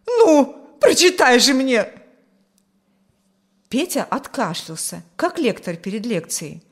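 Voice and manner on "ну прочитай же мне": high, loud, urgent outburst; impatient demand. A narrator's reading. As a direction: beg and order at once. Mother impatiently demands the letter read. (нетерпеливо, громко)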